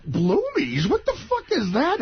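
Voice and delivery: high-pitched retold disbelief; mock-appalled, rising question; incredulous outrage. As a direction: balk at an absurd word, pitch shooting up. Retelling his outraged disbelief.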